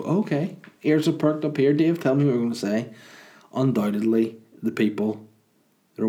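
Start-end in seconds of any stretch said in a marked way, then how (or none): 0.0-2.9 eager listener. Retold or imitated. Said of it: "people are gonna go".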